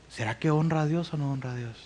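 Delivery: quick, low, soft inner voice; hushed self-questioning. Acted. Believer weighing each step before God, hushed. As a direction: wonder it quietly to yourself, quickly.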